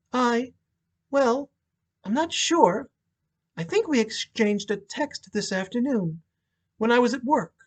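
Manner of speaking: searching her memory, holding back tears; hesitant widow. Acted.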